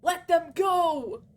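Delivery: hammed-up cry of let them go; mock pleading girl. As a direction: ham up her desperate plea. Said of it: "She's probably gonna type something like".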